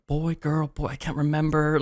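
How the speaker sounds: muttered quietly as a thought; puzzled thought, half under the breath; inner monologue. Retold or imitated. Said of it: "in my head, I was like".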